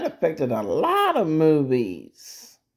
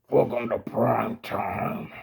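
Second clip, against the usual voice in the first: lower, softer echo of a villain's line; movie villain catchphrase; 4 semitones lower, 5 dB quieter than usual. Acted. Echoing the horror film's line, low villain voice. Second speaker echoes the film line.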